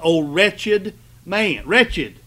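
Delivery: "wretched" drawn out slow and leaned on hard; stress on wretched. Shown as a demonstration. Preacher pressing on "wretched" to his congregation. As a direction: dwell on "wretched", then hit it alone. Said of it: "I want to emphasize".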